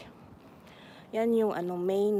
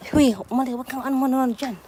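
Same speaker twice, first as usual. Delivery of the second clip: usual speaking voice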